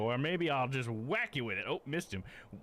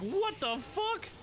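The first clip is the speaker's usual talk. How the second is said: much higher, slow mock-startled outburst; a hammed-up baffled stranger; imitating a startled guy; 12 semitones higher, slower than usual. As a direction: play the guy caught off guard, squeaky. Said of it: "And that guy's like".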